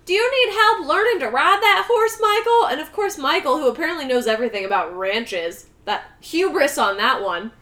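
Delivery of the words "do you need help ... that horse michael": mock helpful kid voice. Tommy's offer voiced in retelling.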